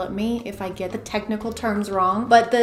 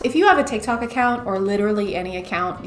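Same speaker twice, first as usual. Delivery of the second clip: usual speaking voice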